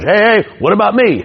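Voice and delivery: high heckler yelling out from the crowd; mock heckler shout; heckler call-out. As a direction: pitch it way up, a cocky heckler butting in. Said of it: "get his pound of flesh".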